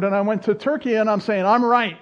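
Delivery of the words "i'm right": emphatic. Retold or imitated. Retold certainty abroad.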